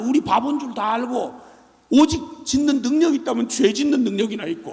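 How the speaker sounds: normal talking voice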